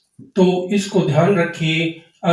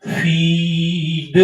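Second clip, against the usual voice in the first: drawn-out demo word; much slower than usual. Shown as a demonstration. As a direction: sound out फीड slowly for the class. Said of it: "जैसे हमने बोला".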